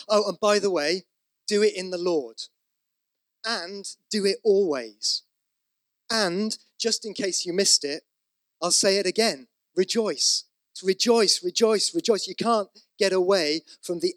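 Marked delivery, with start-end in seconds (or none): 0.1-10.4 louder and higher, playful insistence as Paul; mock insistent Paul. Preacher voices Paul's insistence. 10.8-12.3 each rejoice punched louder and higher; emphatic repetition. Acted. Preacher hammers the repeated word.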